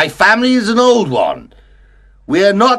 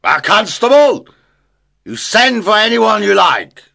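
a mock-brave drunk shouting, mildly louder; scornful shout; 3 semitones higher, 5 dB louder than usual. A narrator's reading. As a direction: jeer at the closed door. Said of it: "shouted at the closed door".

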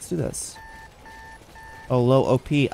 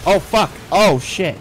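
startled cursing; 3 semitones higher, 7 dB louder than usual. Real, not acted. Startled by boss attack.

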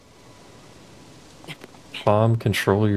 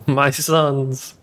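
high put-on villain voice with cackle; 5 semitones higher than usual. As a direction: gloat over your sons like a cartoon villain. Villainous commander voice.

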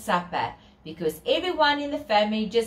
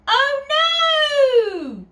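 high, loud cry of dismay; 6 dB louder than usual. Acted. Storyteller crying out to children as lights go. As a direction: gasp it out as the lights fail.

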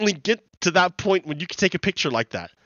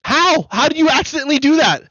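incredulous; 8 semitones higher than usual. Real, not acted. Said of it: "How's that an accident?".

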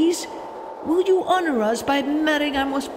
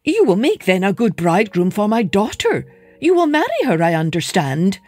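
hopeful Mole; 2 semitones higher, 6 dB louder, faster than usual. Acted. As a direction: rush ahead with rising hope. Mole's hopes rise.

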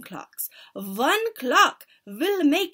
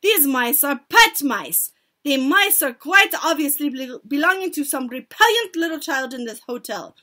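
loud, higher-pitched scolding as the witch; shouted; 4 semitones higher, 9 dB louder than usual. A narrator's reading. Grand High Witch denouncing the pet mice, shouted. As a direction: declaim it loudly to a hall. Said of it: "she shouted".

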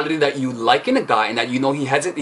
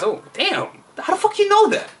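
impressed guy; 14 semitones higher than usual. Retold or imitated. Coach imitating an amazed guy reacting to a cold read, high and stunned. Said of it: "And that makes guys".

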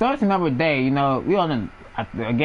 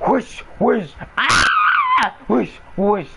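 mock belt whipping and screams; 6 semitones higher, 3 dB louder, much slower than usual. Cousin getting whooped, belt sounds and screaming. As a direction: belt whooshes with a high shouted cry.

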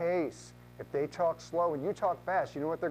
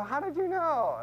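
surprised client; 14 semitones higher, 4 dB louder than usual. Retold or imitated. Client answers in retold chat.

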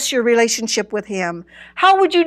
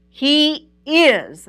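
raised pitch, "is" held firmly; stress on IS; 4 semitones higher than usual. Real, not acted. Preacher quoting her present-tense claim, "is" stressed. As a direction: set "is" apart as the answer. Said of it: "I want to emphasize the word is".

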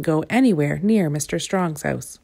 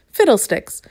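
higher, louder scoffing exclamation; stubborn girl waves off Mister Strong; snorting scorn; 5 semitones higher, 4 dB louder than usual. A narrator's reading. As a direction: scoff at his worry. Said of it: "snorted Little Miss Stubborn".

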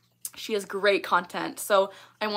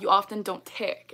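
ordinary delivery

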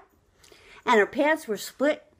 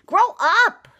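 scolding; 9 semitones higher, 8 dB louder than usual. Real, not acted. Scolding a wayward celebrity.

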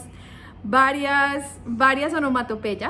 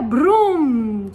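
brum roared out loud; motorbike vroom; 7 dB louder than usual. Shown as a demonstration. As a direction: rev like a motorbike. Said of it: "si sale de una moto". (brum fuerte de moto)

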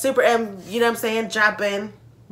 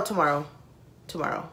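usual speaking voice

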